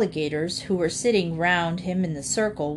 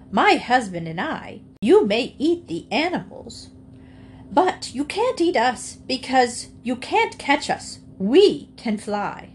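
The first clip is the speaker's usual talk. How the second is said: higher, triumphant retort; defiant hen; 5 semitones higher than usual. A narrator's reading. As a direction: taunt the alligator as one who is out of reach. Henrietta defies the alligator.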